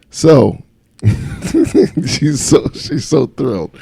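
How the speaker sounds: laughing through the words. Real, not acted. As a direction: giggle while saying it. Laughing over wife's sarcasm.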